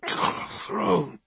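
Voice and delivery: weak slurred groan of a word. Acted. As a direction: slur it through pain. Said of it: "he slurred".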